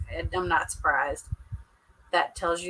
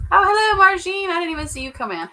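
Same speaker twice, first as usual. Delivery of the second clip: high, loud happy hello to a newcomer; light up and call out a welcome; excited greeting; 10 semitones higher, 7 dB louder, faster than usual. Real, not acted. Greeting a viewer who joined.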